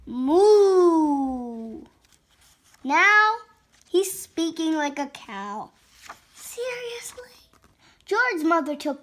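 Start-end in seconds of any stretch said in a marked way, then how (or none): 0.0-1.8 long drawn-out moo; cow moo. Acted. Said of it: "George went". 6.3-7.5 high, hushed whisper; whispered disbelief. Acted. Disbelief at the mooing dog.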